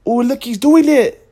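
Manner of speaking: imitating an overexcited tourist; mock excited tourist. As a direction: point and shout like a tourist who found a rare sight. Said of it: "people from Japan were like".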